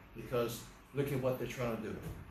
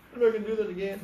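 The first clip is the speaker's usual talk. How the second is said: high, louder imitation of a pleading boy; mock tearful promise; 8 semitones higher, 5 dB louder, much faster than usual. Scolded boy promises the driver he'll stop, mock tearful. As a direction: act out a crying boy's insistent promise. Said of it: "He's crying".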